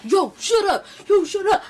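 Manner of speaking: high, loud mock yell; imitated yelling. Boys running into the party to hush the crowd, mock yelling. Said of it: "they yelling".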